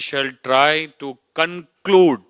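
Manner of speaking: slow down and punch "conclude"; emphasis on CONCLUDE. Real, not acted. Speaker quoting the treaty clause to the panel, stressing CONCLUDE. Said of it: "I am emphasizing the words".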